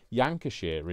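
"Yankashire" for Lancashire; blended county name. Pretend. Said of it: "is Yorkshire and, and Lancashire mixed together".

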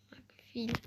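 breathy whispered aside. Real, not acted. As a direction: whisper it to yourself. Fısıltıyla oyun yorumu. (fısıltı)

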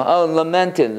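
normal talking voice

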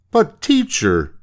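put-on very high, drawn-out boy's protest; protesting schoolboy. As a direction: plead with the teacher in a slow, high voice. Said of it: "said Butch".

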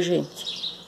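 ordinary talk, nothing marked

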